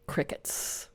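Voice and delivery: a dry, deadpan whisper; whispered crickets. Retold or imitated. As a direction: let it fall into silence, barely breathed. Nothing happens after big plans.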